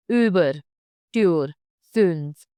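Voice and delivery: ÜBER, TÜR, FÜNF with tightly puckered Ü. Shown as a demonstration. Teacher giving Ü example words, puckered lips. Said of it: "pucker up like you're about to whistle".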